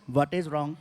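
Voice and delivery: low, unbothered driver voice; low relaxed voice of a puzzled driver; calm driver. Retold or imitated. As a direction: play the clueless calm driver.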